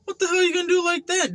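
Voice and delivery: very high, fast, scoffing; mocking disbelief. Mocking the guy's old-timey boxing pose, high squeal. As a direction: squeal it at his silly stance. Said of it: "I'm like".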